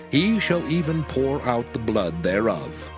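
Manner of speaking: usual speaking voice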